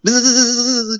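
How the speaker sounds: loud, high insect buzz; high buzzing imitation. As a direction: buzz like a cartoon bee character. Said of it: "a high pitch and had it bzz".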